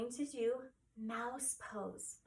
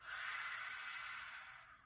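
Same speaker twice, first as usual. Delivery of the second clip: soft breathy lion exhale; lion's breath exhale; 8 dB quieter than usual. Shown as a demonstration. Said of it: "One more time".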